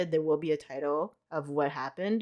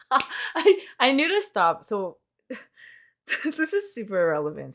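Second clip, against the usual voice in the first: giggling, pitched up high; laughing; 6 semitones higher, 5 dB louder than usual. Real, not acted. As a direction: laugh through the words. Laughing at own cowboy voice.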